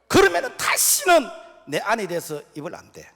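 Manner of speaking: loud, high preaching shout. Real, not acted. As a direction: ring it out to the hall. (크게 외치는 설교)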